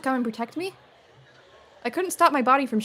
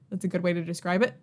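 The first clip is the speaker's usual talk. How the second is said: normal talking voice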